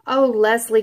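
loud calling cry of his name; cried out. Acted. Cousins crying out to Leslie not to go, loud. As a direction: call out to stop him going. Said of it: "cried both his cousins".

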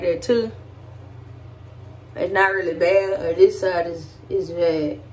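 speaking with lip held open; talking while showing teeth; lip pulled down by fingers. Real, not acted. Seen 1: Fingers pull lower lip down showing braces, about -2.0 to 3.1.

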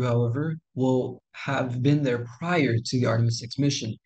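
ordinary talk, nothing marked

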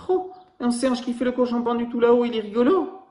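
high, delighted; giggle-voiced wonder at the monkey; amused child. Baby koala points at the swinging monkey, in a high delighted voice.

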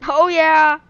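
high, very loud whoop; triumphant loud cry; loud cheer. Real, not acted. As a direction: cheer yourself on loudly. Cheers own driving.

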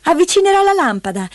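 put-on high child's voice; high-pitched, eager interjection; eager little girl. A narrator's reading. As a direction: chime in brightly as a small girl. Heidi breaks in eagerly.

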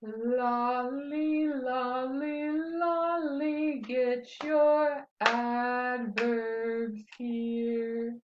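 very slow, drawn-out chant; slow-motion chant, each syllable held long; slowly. Shown as a demonstration. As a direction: stretch every word of the chant out slowly. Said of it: "let's do it slowly".

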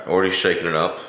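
ordinary delivery

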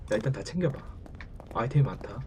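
breathy whisper to himself. Real, not acted. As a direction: whisper it low and tense. Whispering during tense game.

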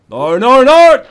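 high, loud cry of no rising to a yell; panicked shout. Real, not acted. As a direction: yell it as you lose your grip. Character slipping off.

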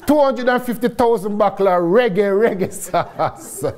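high start, slowing into stretched Jamaican; Jamaican accent. Acted. Entrepreneur announcing the huge order to the chief, in hammed-up Jamaican. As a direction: show off a broad, proud Jamaican accent. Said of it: "in my best Jamaican voice".

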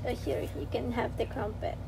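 ordinary delivery

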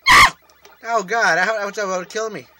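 loud, high exclamation; alarmed outburst. Real, not acted. Player after a near miss in the game, alarmed outburst. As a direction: blurt it out in alarm.

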